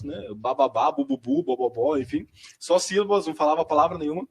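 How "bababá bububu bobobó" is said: put-on a toddler's wordless babbling on ba, bu and bo; syllables only, no words; baby babble. Shown as a demonstration. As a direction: babble the syllables like a child who can't talk yet.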